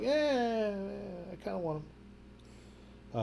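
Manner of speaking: high, drawn-out "eh" sinking very soft; reluctant wavering. Retold or imitated. Shopper recalling being torn over Ghostbusters copies, reluctant wavering. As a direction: waver aloud, trailing away slowly.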